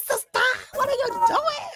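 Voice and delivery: loud high-pitched shout. Real, not acted. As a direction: call out loudly. Loud greeting call to friend.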